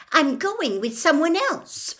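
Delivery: high, loud, indignant outburst; angry, exasperated announcement. A narrator's reading. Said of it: "very angrily".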